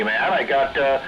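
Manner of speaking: normal talking voice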